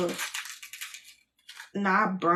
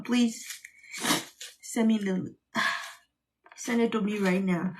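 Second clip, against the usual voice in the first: normal talking voice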